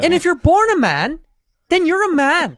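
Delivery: Jordan Peterson. Acted. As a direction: protest loudly, stretching each word up high. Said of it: "his Jordan Peterson impression".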